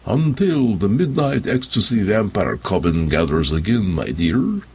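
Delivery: put-on lightly Slavic vampire count accent; a hint of a Slavic charmer. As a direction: suave Slavic accent, faintly done. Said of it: "put on a Slavic accent when he said".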